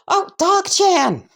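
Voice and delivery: high, drawn-out delighted call; excited greeting. Acted. Izuku spots Kacchan in class, high excited greeting. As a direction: call out to an old friend in a high voice.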